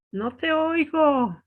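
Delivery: slow, a hint of an old grandpa; lightly voiced grandpa. Grandpa who can't hear, slow and old. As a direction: drawl it like a hard-of-hearing old man.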